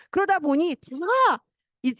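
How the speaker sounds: ordinary talk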